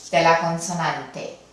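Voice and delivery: ordinary delivery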